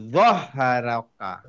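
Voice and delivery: জহরাকা drawn out slow with a deep Arabic ধ sound; Arabic letter demo. Shown as a demonstration. Teacher models জহরাকা for students, slow and careful. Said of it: "এইটা উচ্চারণটা করি".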